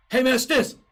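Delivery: loud, high, slurred phone greeting; Stinson impression. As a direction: bellow it like Stinson, words run together. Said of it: "That's how he talks, right?".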